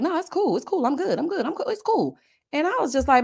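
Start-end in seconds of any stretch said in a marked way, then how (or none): 0.0-1.7 mock nonchalant "it's cool", higher; brushing it off in a higher put-on voice; lightly voiced hurt man pretending he's fine; imitating William. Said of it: "he was just like". 1.7-2.2 "cool" pitched much higher and dragged; mock brush-off squeaking up on "cool"; imitating William. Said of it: "he was just like".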